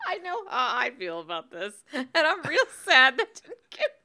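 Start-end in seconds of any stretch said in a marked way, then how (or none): 1.9-3.9 high snorting laughter; snort-laugh through the words. Real, not acted. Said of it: "I just snorted twice".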